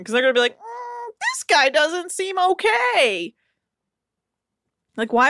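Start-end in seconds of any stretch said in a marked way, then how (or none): 0.6-3.3 high, slow, drawn-out doubt; mock wary onlooker. Onlookers reacting to the pan-bashing chef, mock hesitant concern. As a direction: put on a high voice of hesitant judgement.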